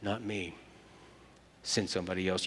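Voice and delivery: low, soft, hushed reprise; quiet echo. Acted. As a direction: let it fall away to almost nothing. Quiet reprise of Moses' refusal.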